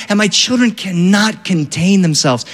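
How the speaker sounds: ordinary talk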